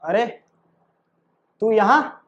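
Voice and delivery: normal talking voice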